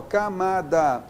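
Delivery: "camada" slow and even, every syllable clear; each letter sounded. Shown as a demonstration. Teacher repeating the example, "camada" slowly syllable by syllable. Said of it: "cada letra com seu som".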